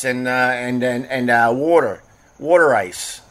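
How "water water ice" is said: imitated Philly "water"; mock Philly vowels; Philly accent. Shown as a demonstration. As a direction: say it like a Philadelphian. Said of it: "I think that's how they say it".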